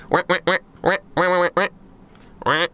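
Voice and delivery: a run of high-pitched staccato quacks; imitated duck quacks. Quackington, fist to chest, quacks solemnly to Damon. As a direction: quack out a heartfelt speech without words. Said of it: "puts it to his chest and goes".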